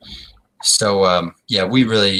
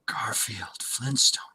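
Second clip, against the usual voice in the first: whisper; 9 dB quieter, much slower than usual. Acted. Repeating the name in secret.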